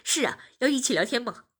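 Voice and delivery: put-on hearty, loud young man; loud friendly stranger. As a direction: invite them over cheerfully. Voices a male stranger. (热情洪亮的男生)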